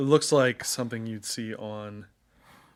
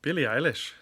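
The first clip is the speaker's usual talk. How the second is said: Irish accent; 4 semitones higher than usual. Shown as a demonstration. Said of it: "Can you?".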